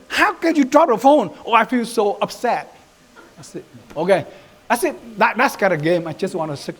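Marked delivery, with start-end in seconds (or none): 0.1-1.3 high-pitched mock yell of a dad; scolding daddy. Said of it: "shot me". 1.4-2.7 high, hammed-up weight on "upset"; stressed emotion word. Shown as a demonstration. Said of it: "I stress the word".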